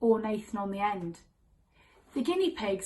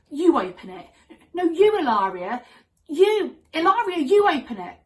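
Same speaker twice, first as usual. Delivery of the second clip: loud, mock childish bickering; squabbling kids; 6 semitones higher, 8 dB louder than usual. Sisters daring each other to open the tin, bickering. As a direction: push the job onto each other, voice climbing.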